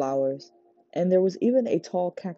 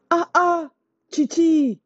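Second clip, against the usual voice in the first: joyful greeting; 14 semitones higher, 6 dB louder, slower than usual. A narrator's reading. Uncle greeting niece joyfully.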